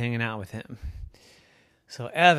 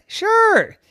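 very high, loud mouse voice; little mouse; 6 dB louder than usual. Acted. Field mouse accepting invitation, squeaky little voice. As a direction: pipe up loudly and eagerly.

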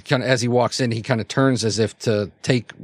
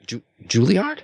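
halting, higher-pitched question of recognition; astonished; 5 semitones higher than usual. Acted. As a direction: stumble on the name in disbelief. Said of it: "her astonished look".

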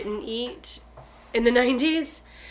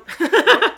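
high, loud laugh; 8 semitones higher, 9 dB louder than usual. Real, not acted. Laughing at own skin cancer joke, high laugh. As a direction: burst out laughing.

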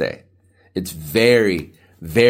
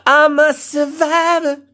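drawn-out, much higher croak; strained high voice; 4 dB louder, slower than usual. Shown as a demonstration. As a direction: stretch it out high and slow like a cracked morning voice. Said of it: "My voice, dude".

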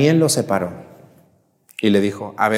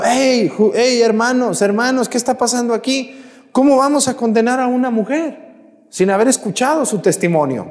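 calling out; 9 semitones higher than usual. Acted. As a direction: cry out to stop the crowd. Young Daniel calls out to crowd.

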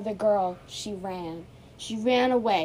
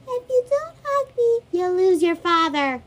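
too calm creepy doll; 10 semitones higher, 10 dB louder than usual. Pretend. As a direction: coo the threat like a sinister doll. Doll threatens Emma.

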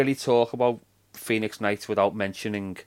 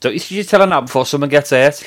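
normal talking voice

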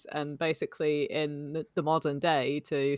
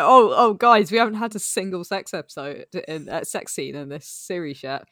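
louder, hammed-up urgent panic; mock panicked rush; 3 semitones higher, 7 dB louder than usual. Imitating writers rushing to add a sex scene, hammed-up panic. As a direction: mimic frantic writers cramming it in. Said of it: "They were like".

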